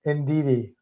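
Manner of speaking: ordinary talk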